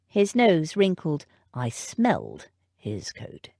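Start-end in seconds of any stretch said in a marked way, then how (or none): 1.5-3.5 slow, soft, measured butler; a hint of a thoughtful old butler; musing butler. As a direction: weigh each word quietly. Butler notes the smell.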